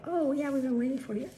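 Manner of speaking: lightly voiced staff welcome; staff greeting. Retold staff greeting the fourth person.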